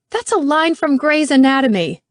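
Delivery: high, loud incredulous retort; furious. Acted. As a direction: snap back in disbelief.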